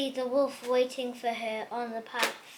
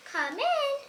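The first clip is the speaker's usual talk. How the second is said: high, lightly voiced old granny; a small, high old-lady call; a hint of a sweet grandmother at the door; 13 semitones higher than usual.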